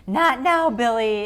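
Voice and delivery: loud campy shout from the grave; campy yell. Retold or imitated. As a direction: bellow it like a hammy ghoul dad. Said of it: "yelling out of the grave saying".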